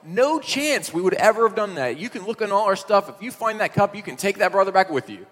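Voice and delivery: high, loud protest of innocence; indignant brothers. Retold or imitated. Brothers protesting to the servant, high and loud. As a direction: insist you never did it. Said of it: "And the brothers are like".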